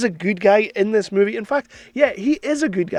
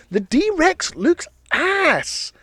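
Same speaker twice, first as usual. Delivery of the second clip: drawn-out verdict; 9 semitones higher, much slower than usual. Real, not acted. Blunt verdict on design.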